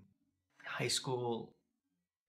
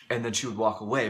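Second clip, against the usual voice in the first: usual speaking voice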